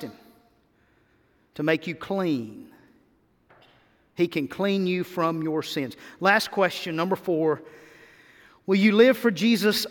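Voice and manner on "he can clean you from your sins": ordinary talk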